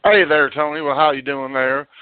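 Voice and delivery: usual speaking voice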